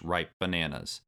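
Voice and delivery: ordinary delivery, nothing marked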